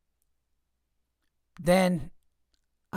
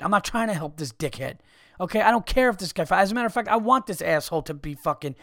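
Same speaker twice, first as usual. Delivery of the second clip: ordinary delivery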